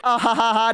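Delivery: high mock laugh; imitated elf giggle. Elf laughing among the orcs, gleeful. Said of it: "And she's like".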